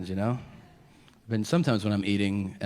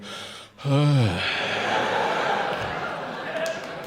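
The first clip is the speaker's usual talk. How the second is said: long unvoiced gasp for air mid-meal; breathless sigh; 3 semitones higher than usual. Shown as a demonstration. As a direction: heave out an exhausted, airy sigh. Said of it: "you're eating and you go".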